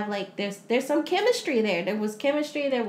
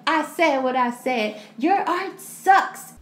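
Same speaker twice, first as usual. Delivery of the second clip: mock harsh husband; 4 semitones higher, 5 dB louder, slower than usual. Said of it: "He said".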